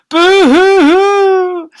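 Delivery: very high, loud, slow boo-hoo-hoo; comic bawling; hammed-up sobbing. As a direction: wail like a cartoon. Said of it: "burst into tears as well".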